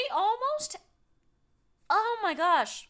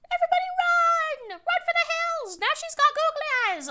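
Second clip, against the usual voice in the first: shouting; 13 semitones higher, 8 dB louder than usual. Pretend. Mock panic at scary lady.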